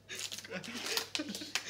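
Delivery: a quick amused chuckle at herself; short laugh. Real, not acted. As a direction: laugh off the fiddly packet. Laughs at unopened packet.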